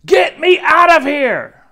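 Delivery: put-on high, very loud child's yell; shouting. A narrator's reading. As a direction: yell to be let out. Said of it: "I just shouted".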